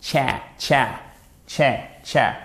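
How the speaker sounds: tcha repeated with A leaning toward è; English short A. Shown as a demonstration. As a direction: drill tcha, each one a separate "tchè"-like syllable. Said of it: "vous le faites avec l'arrière de la bouche".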